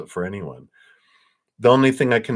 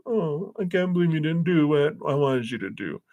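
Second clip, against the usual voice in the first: high, whiny fake sob; hammed-up tearful guilt trip, pitched way up; mock crying; 9 semitones higher than usual. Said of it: "I get sadsies and I cry".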